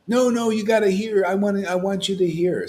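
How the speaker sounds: high, eager pleading; insisting poet. Retold or imitated. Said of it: "I don't wanna hear his poetry".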